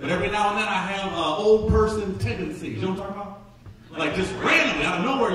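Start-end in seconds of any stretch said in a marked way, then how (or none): none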